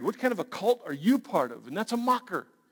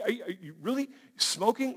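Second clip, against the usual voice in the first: normal talking voice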